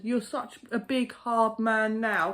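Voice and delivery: high, very slow sneering taunt; mocking taunt. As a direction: drag out big and hard to mock him. Taunting the aggressive man.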